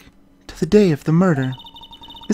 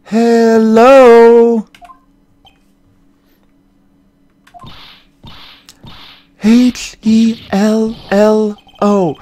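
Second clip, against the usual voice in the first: loud calling out; 3 semitones higher, 8 dB louder, much slower than usual. Acted. Maya shouting at the rude woman to get her attention. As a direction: shout each letter, slowly and loudly.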